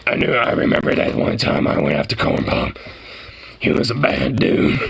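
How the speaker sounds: very low, croaky storyteller imitation; hammed-up five-pack-a-day voice; gruff smoker. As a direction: reminisce in a mock smoker's rasp. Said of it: "everyone sounds like they have a five pack a day habit".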